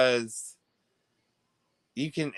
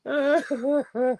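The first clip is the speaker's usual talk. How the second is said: high laugh; 11 semitones higher than usual. Real, not acted. Laughing at the story.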